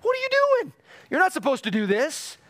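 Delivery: hammed-up indignant outburst; Samuel's outraged surprise. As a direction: scold like a prophet woken from the dead. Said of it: "Samuel ... is like".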